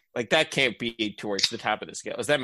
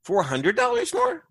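surprised; 4 dB louder than usual. Real, not acted. Disbelief at cousin's refund.

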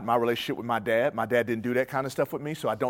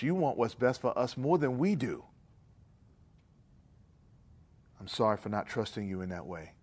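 closing prayer; 2 semitones lower, 4 dB quieter than usual. Acted. Preacher praying over his congregation, quiet and humble. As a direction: pray humbly and softly over the room.

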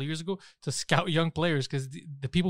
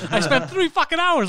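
high, loud gamer meltdown, retold; comic losing-it outburst; mock outraged yelling; 12 semitones higher, 5 dB louder than usual. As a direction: rant in pretend fury, laughter breaking in. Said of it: "I'm just losing my shit".

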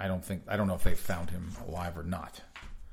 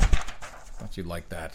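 slow, lower, deadpan tease; low teasing voice; 5 semitones lower, slower than usual. Acted. Repeat in low voice.

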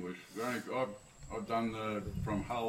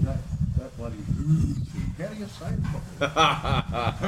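loud tipsy tease; 4 semitones higher, 8 dB louder than usual. Real, not acted. Tipsy, laughing teasing question.

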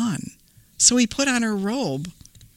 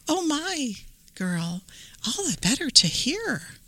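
soft fake granny voice; 8 semitones higher, 5 dB quieter than usual. Wolf disguised as granny.

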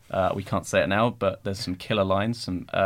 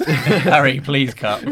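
laughing plea; 4 semitones higher, 8 dB louder, much slower than usual. Retold or imitated. Retold begging, laughing.

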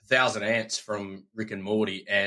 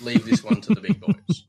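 laughing taunt; 12 semitones higher, 6 dB louder than usual. Real, not acted.

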